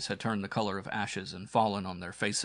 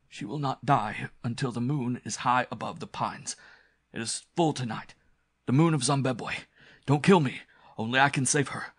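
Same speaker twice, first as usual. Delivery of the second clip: urgent, strained villain voice; frightened pleading that builds in urgency; desperate cult priest, pleading; 3 semitones higher than usual. Acted. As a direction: bargain anxiously for your life. Villain pleads for his life.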